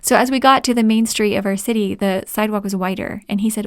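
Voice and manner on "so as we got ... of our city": ordinary talk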